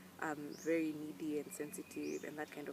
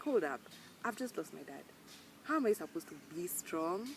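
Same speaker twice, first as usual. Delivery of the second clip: ordinary talk, nothing marked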